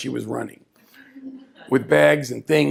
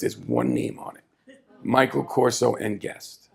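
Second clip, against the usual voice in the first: usual speaking voice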